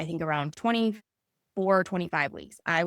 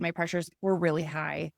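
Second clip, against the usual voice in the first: ordinary delivery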